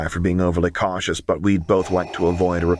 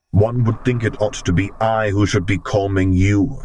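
deep rumble; 2 semitones higher, 5 dB louder than usual. A narrator's reading. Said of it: "he rumbled".

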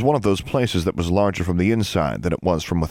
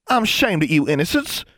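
high-pitched ashamed reproach; high, reproachful country-girl voice; scolding; 12 semitones higher than usual. A narrator's reading. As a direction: reprove a little sister, pitched up. Older sister scolds the younger.

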